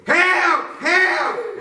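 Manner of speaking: high, loud cry for help; mama crying for help. Acted. Mama screaming from the car window. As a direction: shout it like a panicked mother. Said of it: "she said".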